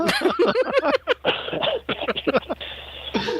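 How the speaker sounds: high squealing burst of laughter. Real, not acted. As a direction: crack up laughing hard. Laughing at own story.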